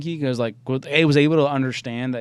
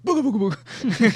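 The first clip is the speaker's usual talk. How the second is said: high-pitched playful boo; playful scare; 10 semitones higher, 4 dB louder than usual. Acted. Dog owner jumping out at Waylon, playful scare. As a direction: burst out with it to startle. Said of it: "then I'll scare him".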